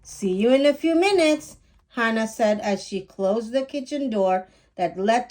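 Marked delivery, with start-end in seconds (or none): 0.0-1.6 put-on light girl's call. Hannah calling as she leaves.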